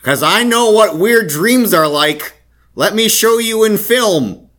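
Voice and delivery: high, loud, slow nasal yell; half-yelled Gordon Cole rant; David Lynch impression. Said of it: "I found my David Lynch impression".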